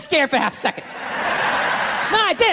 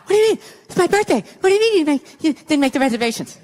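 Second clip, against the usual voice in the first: freaking out; 3 semitones higher than usual. Retold or imitated. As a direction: imitate her flipping out, pitched up. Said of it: "She starts freaking out".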